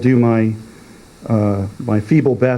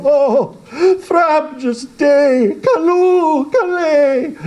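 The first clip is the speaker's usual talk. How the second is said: chortling joy; slower than usual. Acted. Father hugging his son after the slaying, overjoyed and high. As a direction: burst with delight at the victory. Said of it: "he chortled in his joy".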